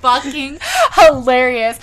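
loud, high, words through laughter; helpless with laughter; laughing hard. Real, not acted. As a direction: crack up while talking. Cracking up over hilarious video.

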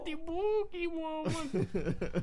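high, slow drawn-out name, laughing through it; laughing, hammed-up spooky voice; spooky mock call. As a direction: croon the name like a ghost story.

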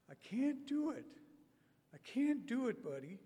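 remember him in his high voice; Robert's high voice. Retold or imitated. Preacher recalling Robert's words in his high voice. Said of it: "that spirit of his lives on".

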